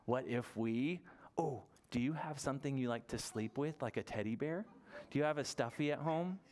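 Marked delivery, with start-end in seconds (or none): none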